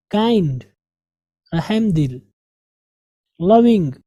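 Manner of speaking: normal talking voice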